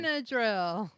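tongue held, words thick and blurred; holding tongue. Real, not acted. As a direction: speak while holding your tongue. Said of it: "I'm holding my tongue".

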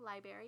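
"library" as LIE-berry, first r missing; mispronounced library. Retold or imitated. Child repeating the word for mom, mispronounced library.

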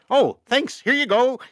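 tossing it off like a friendly handoff; mock cheerful character. Romero's character hands a lit dynamite to a zombie, breezy and chipper. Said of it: "he goes".

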